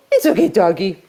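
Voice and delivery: high coaxing pet voice; coaxing a dog. Retold or imitated. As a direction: sweet-talk a dog into obeying. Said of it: "I try a different tactic".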